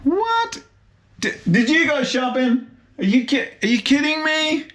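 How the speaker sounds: high, astonished disbelief; can't believe the choice; incredulous. Real, not acted. Amazed at the toothpaste shelf.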